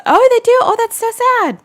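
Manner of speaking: high-pitched shocked pity; surprised sympathy. Real, not acted. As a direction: gush with startled sympathy. Reacting to a viewer's comment.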